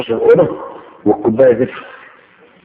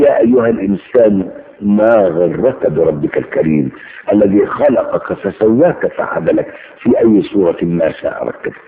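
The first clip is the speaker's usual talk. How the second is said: slow, lowered recital of scripture; Quran recitation; 5 semitones lower, slower than usual. Acted.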